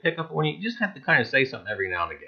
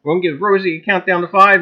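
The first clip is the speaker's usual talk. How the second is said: ordinary delivery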